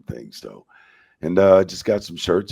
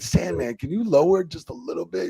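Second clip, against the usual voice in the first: pleading shout; 11 semitones higher than usual. Retold or imitated. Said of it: "I'm like".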